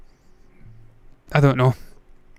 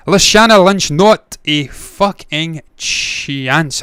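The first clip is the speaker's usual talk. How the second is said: loud name, then NOT A FUCKING CHANCE word by word; emphatic dismissal; 3 semitones higher, 4 dB louder, much slower than usual. Real, not acted. Vlogger ruling out a Bond candidate, emphatic dismissal.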